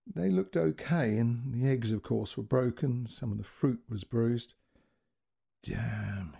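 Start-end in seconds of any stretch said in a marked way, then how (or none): 5.6-6.3 whispered, softer annoyed thought; whispered curse. A narrator's reading. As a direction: breathe the curse to yourself. Inner curse over broken eggs.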